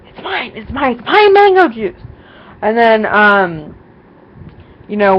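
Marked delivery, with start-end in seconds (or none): 0.1-2.0 put-on high, loud childish shriek over a prized drink; possessive yell. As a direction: shriek it like a child guarding a treat. Possessive outburst over the juice.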